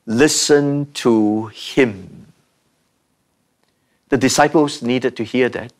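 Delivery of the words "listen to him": very slow, low "Listen to him"; solemn divine command. Acted. Preacher quoting the voice from heaven, slow and solemn. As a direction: draw out each word of "Listen to him".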